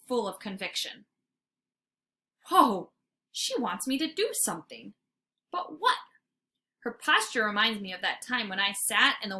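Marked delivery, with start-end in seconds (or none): none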